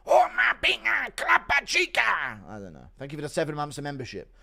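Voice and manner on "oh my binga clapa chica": put-on high accented gibberish chant; Romero impression.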